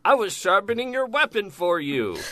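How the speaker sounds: a creepy crone proudly announcing a favour; sweet creepy old lady. Retold or imitated. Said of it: "she says".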